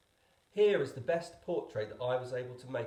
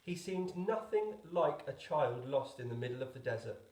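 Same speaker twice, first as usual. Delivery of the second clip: ordinary delivery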